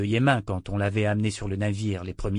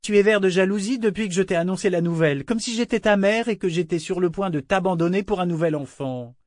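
teasing; 8 semitones higher than usual. A narrator's reading. Etta mocking his jealousy.

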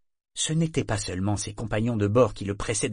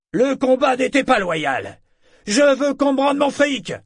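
high, loud protest; indignant exclamation; 7 dB louder than usual. A narrator's reading. Gambler demands his money back from the bear trainer, exclaiming indignantly. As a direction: shout your demand far above your usual pitch. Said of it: "s'exclama un autre".

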